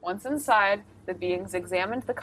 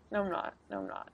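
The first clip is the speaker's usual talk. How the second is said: ordinary delivery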